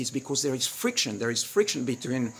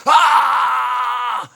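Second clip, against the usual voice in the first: loud high yell back; screaming back at her at full force; retold scream; 12 semitones higher, 12 dB louder than usual. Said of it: "And I scream back. I go like".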